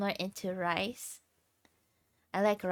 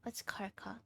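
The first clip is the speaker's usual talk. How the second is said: whispered puzzled reread; hushed and baffled; 12 dB quieter than usual. Real, not acted. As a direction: breathe the question out under the breath.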